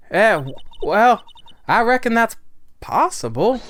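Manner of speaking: high, loud folksy witness voice; hesitant witness. Acted. Acts the witness, hesitant answer.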